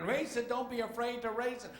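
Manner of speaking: high, loud yell over the engine; mechanic shouting. Acted. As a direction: holler urgently to be heard over the racket.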